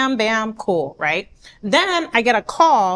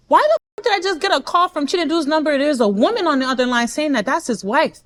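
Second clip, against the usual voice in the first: high-pitched, indignant questioning; outraged demand; 4 semitones higher, faster than usual. Retold or imitated. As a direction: demand answers from his best friend. Demands answers from best friend.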